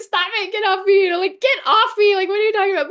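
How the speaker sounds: high, loud mock-annoyed teenager; teen brushing off. Imitating her daughter pushing her away, high mock annoyance. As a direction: shove her off in a whiny high voice. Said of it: "she's like".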